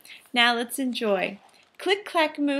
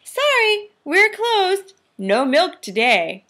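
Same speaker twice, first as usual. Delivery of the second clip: note voice; 8 semitones higher, 5 dB louder than usual. Acted. Cows' note read.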